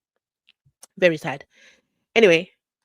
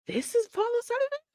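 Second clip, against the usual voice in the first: incredulous; 4 dB quieter, faster than usual. Real, not acted. Disbelief repeated.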